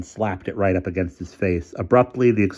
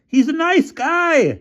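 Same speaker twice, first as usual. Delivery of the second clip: very high, slow, louder shout; shouting from the crowd; 6 dB louder, slower than usual. A narrator's reading. Bruno shouting from the crowd for his friend. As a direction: call out over the crowd. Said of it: "shouted Bruno from the crowd".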